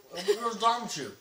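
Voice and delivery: loud, high, laughing complaint; laughing disgust. Real, not acted. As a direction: laugh out the verdict. Laughing disgust after tasting.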